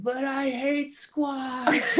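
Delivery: put-on high, slow, sobbing little-kid whine; bawling child. Dad imitating his son bawling in a high whine. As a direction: mimic a four-year-old wailing about squash. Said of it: "he starts bawling and he's like".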